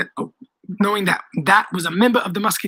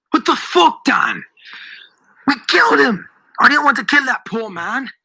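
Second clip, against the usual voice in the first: high, loud outrage; angry shout; 6 semitones higher, 4 dB louder than usual. A narrator's reading. Boy rebukes Daniel for the killing, shouting angrily. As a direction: shout in appalled anger. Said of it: "I shouted in anger".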